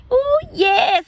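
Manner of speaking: high, loud gushing squeal; excited squeal. Retold or imitated. Friend bragging about her new car, squealing excitedly. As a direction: gush over the blessing, way up high.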